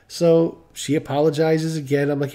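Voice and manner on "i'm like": ordinary talk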